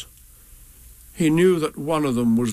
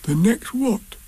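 higher puzzled bear voice; Pooh, puzzled; 11 semitones higher, slower than usual. A narrator's reading. Pooh asking Piglet what was meant, puzzled voice. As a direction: ask it baffled, pitched up. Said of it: "said Pooh to Piglet".

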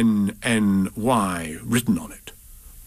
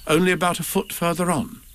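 usual speaking voice

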